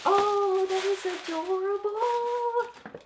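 high, drawn-out gasp of delight; excited gasp. Real, not acted. Vlogger unwrapping a mug from her friend, gasping high and delighted. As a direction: gasp like you just saw a puppy.

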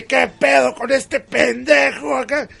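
baffled villain shout. Retold or imitated. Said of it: "Y el Samas así".

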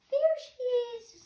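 very high, loud and slow, thrilled; a chick shouting with joy; excited baby bird. Acted. Baby bird spotting the boat, high excited call. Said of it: "my baby bird voice".

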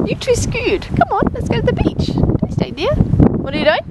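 high teasing voice to a pet; pet talk. Real, not acted.

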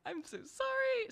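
squeaky, flustered sorry; slow, timid high-pitched apology; high panicked apology. Retold or imitated. Panicked apology to angry customer.

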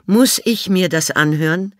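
high, put-on elderly lady, dry; old lady Miss Sophie. A narrator's reading. Old Miss Sophie answers the inspector, high and dry. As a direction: play the haughty old lady.

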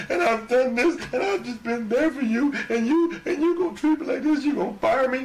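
high, whiny mock sobbing plea; mock crying plea. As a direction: imitate a grown man whining to his boss. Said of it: "crying like a big old bitch".

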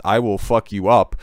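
ordinary delivery

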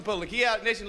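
usual speaking voice

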